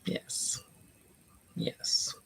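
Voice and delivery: whispered yes; whispering. Shown as a demonstration. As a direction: breathe it without voice. Testing quiet mic voice.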